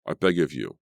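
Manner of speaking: ordinary delivery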